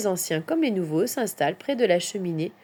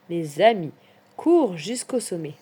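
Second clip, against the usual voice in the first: ordinary delivery, nothing marked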